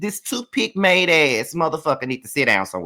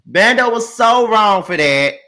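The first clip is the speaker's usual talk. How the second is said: laughing through it, slowed and louder; 5 semitones higher, 7 dB louder than usual. Real, not acted. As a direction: laugh it out, slow and amused. Laughing take of the repeated line.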